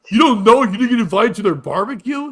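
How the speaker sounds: loud, jeering incredulous question; loud mock astonishment; mocking disbelief. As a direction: throw it back loudly, mock-shocked. Mocking co-host's question back at them.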